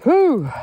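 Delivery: loud breathy high whew of relief after the climb; tired whew. Real, not acted. Walker reaching the top of the hill, loud relieved whew. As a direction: blow it out, glad the hill is over.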